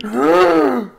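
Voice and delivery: high quivering shudder noise; hammed-up trembling fear sound. Soldiers running to their tents, frightened shudder. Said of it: "and they're shaking".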